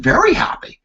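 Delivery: high squeaky very happy; eager reply. Retold or imitated. Two staff answering the president, high and eager. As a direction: answer a little too eagerly.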